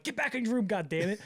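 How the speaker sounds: high-pitched exasperated mock order; rozkaz z irytacją. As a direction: herd unruly patients back, imitating frazzled staff. Staff ordering tripping patients back.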